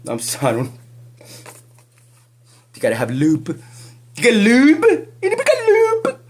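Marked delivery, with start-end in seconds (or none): 4.2-6.2 much higher, louder crying squeal; hammed-up wailing taunt; mock shriek. Mocking advice to the accused.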